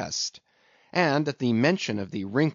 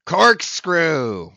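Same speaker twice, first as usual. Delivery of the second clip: loud, higher burst; cry; 6 semitones higher, 9 dB louder than usual. A narrator's reading. Captain supplies the harpooner's word, crying out. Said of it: "cried Ahab".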